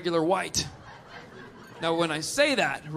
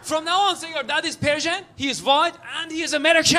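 put-on high-pitched, emphatic Persian accent; insistent Persian accent; 7 semitones higher than usual. Audience member instructing the comic about his dad, Persian accent. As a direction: give orders in a Persian accent. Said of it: "There's no S H in America".

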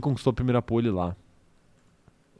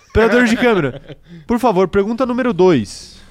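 calling out laughing, higher and louder; 5 semitones higher, 8 dB louder than usual. Real, not acted. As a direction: announce the next question loudly. Calls out to the camera operator.

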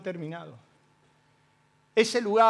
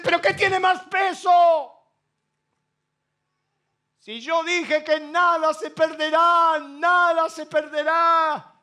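slow, much higher and louder thundering; shouted voice of God; 7 semitones higher, 6 dB louder, slower than usual. Retold or imitated. God shouting his promise at the preacher, slow and thunderous.

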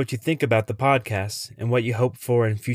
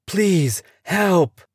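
high, loud begging cry; desperate plea; 9 semitones higher, 5 dB louder than usual. Acted. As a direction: shout out, pleading. Begging strangers for help.

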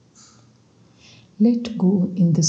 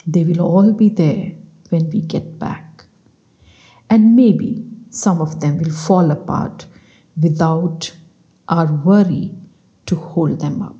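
measured, louder story-reading voice; bedtime reading; 5 dB louder than usual. Acted. Meditation guide reads a book extract to sleepy listeners, measured reading. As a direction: read the extract aloud in unhurried phrases.

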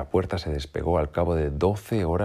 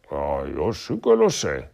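slow, loud eager wolf; wolf shouting his idea; cry out; 6 dB louder, slower than usual. A narrator's reading. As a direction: announce it proudly. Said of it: "gritó".

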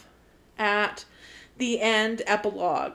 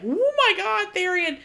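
mock fangirl gush; 10 semitones higher than usual. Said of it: "Everyone was like".

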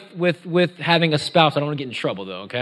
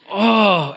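loud, high "oh" of exasperation; acted groan; 5 semitones higher, 4 dB louder than usual. Anyone over fifteen at work, loud groan. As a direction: heave out a big weary groan. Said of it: "If you haven't groaned".

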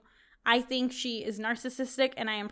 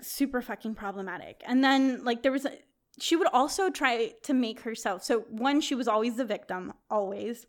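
normal talking voice

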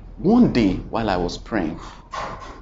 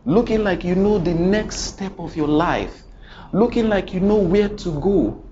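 rising preaching; 6 semitones higher than usual. Real, not acted.

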